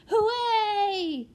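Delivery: put-on very high, loud child's squeal; joyful squeal. A narrator's reading. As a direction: squeal with delight like a small child. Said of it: "squealed the prodigy".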